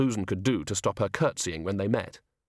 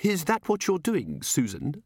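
anxious; 6 semitones higher than usual. Acted. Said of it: "said Missus Gator anxiously".

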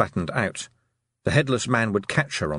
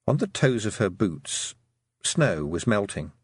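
ordinary delivery, nothing marked

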